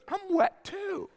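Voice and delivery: high, drawn-out mock wail of a wife; dismayed wife. Wife to husband in the wet bed, dismayed. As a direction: stretch it out slowly in a high wife voice. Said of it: "She goes".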